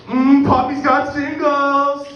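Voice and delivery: slow, high, loud singsong; hammed-up leering. Customer flashing singles at strippers, slow hammed-up leer. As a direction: draw it out with exaggerated relish.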